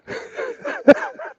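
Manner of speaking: high-pitched helpless giggling; laughing. Real, not acted. Laughing in front of the cashier, helpless giggle. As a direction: giggle away, high and breathy. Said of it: "I'm laughing".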